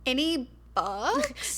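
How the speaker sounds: slow country twang, rising on "Any books?". Shown as a demonstration. Answering friend's question in a slow country twang. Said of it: "That's the accent you've been doing".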